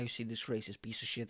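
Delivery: low, soft sneering accuser voice; mock accuser. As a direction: sneer it as an online critic. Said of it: "Be like".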